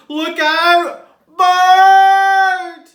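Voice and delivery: loud high panicked warning; shrieking snails in panic; panicked cry. Acted. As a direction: yell it in alarm. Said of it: "cried the snails in panic".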